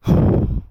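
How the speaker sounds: loud breathy sigh of annoyance; exasperated sigh. Retold or imitated. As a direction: let out a fed-up sigh. Exasperated after hanging up.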